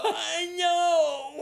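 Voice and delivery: high, loud strained cry; hammed-up choked shout; mock strained yell. Him trying to answer, mock strained shout. Trying to talk while dazed.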